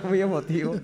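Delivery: chuckles through the words; laughing echo. Real, not acted. Echoing the host's joke about the emotional story, laughing. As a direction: laugh it back at him.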